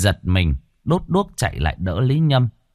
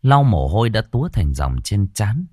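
ordinary delivery, nothing marked

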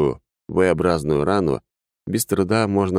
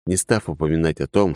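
usual speaking voice

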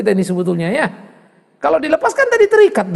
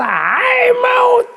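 defiant cry; 12 semitones higher than usual. Acted. Paul mocking death before the church, with a high defiant cry.

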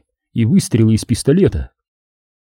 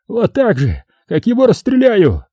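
high-pitched menacing cry; high, angry outburst; shrill threat; 10 semitones higher than usual. A narrator's reading. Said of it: "визгливый голос".

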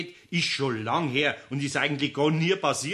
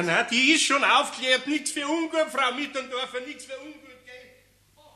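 higher, fading away as he leaves; higher, fading-off parting apology; cheerful apology; 9 semitones higher than usual. Real, not acted. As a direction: brush it off merrily on the way out. Laughing apology while leaving.

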